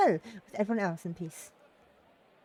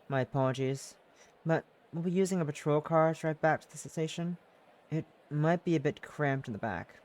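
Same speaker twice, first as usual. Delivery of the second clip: a hint of a lower, polite detective; lightly voiced detective; 3 semitones lower than usual. Detective apologises about the cramped patrol car, lightly lower.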